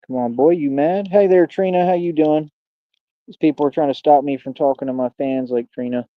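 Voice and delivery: ordinary talk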